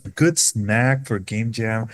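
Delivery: ordinary talk, nothing marked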